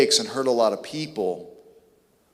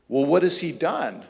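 usual speaking voice

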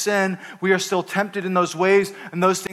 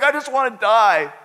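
whiny Jonah; 5 semitones higher, 4 dB louder than usual. Retold or imitated. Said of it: "He's like".

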